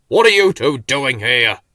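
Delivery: put-on loud gruff old tortoise voice; demanding. A narrator's reading. Slowcoach catching Bill and Ben in his house, demanding loudly. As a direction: challenge the intruders sharply. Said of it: "he demanded".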